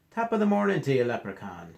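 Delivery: loud, high Irish greeting, dropping at the end; Irish accent. Acted. Fluffy greets the leprechaun, Irish accent. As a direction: call it out in an Irish lilt. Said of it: "speaking in an Irish accent".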